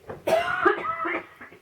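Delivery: long harsh coughing fit, rising; real choking cough. Real, not acted. Tarot reader choking on camera, real coughing fit. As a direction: hack and choke, can't stop. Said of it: "after I've choked".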